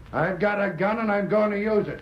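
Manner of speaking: high, forceful threat; threatening shout. Acted. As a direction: yell the threat. Threatening the hidden man.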